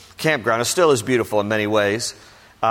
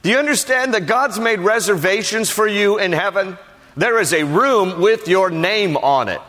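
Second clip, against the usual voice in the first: raised, ringing preacher's voice; preaching; 8 semitones higher than usual. Acted. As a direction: preach it out to the congregation. Preaching from scripture.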